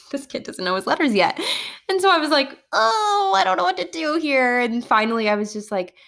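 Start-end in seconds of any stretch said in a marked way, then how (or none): 2.7-4.6 high-pitched retold dismay; flustered. Teacher learning child doesn't know letters, flustered. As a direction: act out being at a loss, voice pitched up. Acts out being at a loss.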